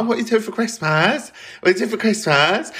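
high, nagging mock question; mocking mimicry. Mimicking people's perpetual Christmas question, high mocking voice. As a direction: imitate people asking it endlessly, pitched right up.

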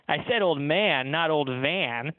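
slow, pointed correction, leaning on man and van. A narrator's reading. Pianist slowly correcting the genie, stressing man against van. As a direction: spell it out slowly for the deaf genie.

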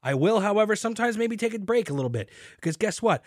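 ordinary delivery

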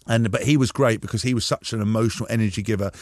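usual speaking voice

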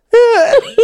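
high, loud laugh; sudden high-pitched laugh; burst of laughter. Real, not acted. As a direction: break out laughing after a rant. Laughing after own rant.

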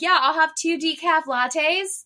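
drawn-out clueless repeat of the order; oblivious customer. Retold or imitated. Customer ignoring the barista's answer, high and slow. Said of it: "She's like".

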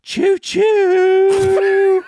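high, loud creepy train call; creepy clown honk. Pretend. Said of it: "That was so creepy".

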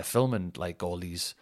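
normal talking voice

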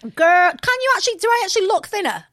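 high, eager question about looking thinner; fishing for the compliment, pitch up; excited disbelief. Real, not acted. Excited about losing weight.

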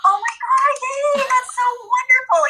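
high, slow, overdone mom praise; fake enthusiasm. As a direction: gush like a mom faking delight. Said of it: "you have to go".

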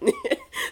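very high, short laugh; a quick high giggle. Real, not acted. Laughing about hearing Britishness.

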